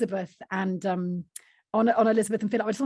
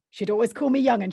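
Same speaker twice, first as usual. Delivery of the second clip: normal talking voice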